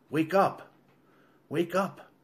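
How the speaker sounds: high-pitched, rising wake-up call; urgent waking call. A narrator's reading. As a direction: rouse a sleeper urgently. Wife wakes him from nightmare.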